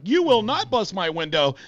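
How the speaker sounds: defiant protest. Retold or imitated. Driver forbidding cop to break his window, high defiance. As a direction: imitate an indignant driver standing his ground.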